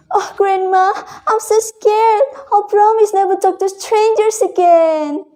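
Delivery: put-on a frightened girl clinging to grandma; scared little girl.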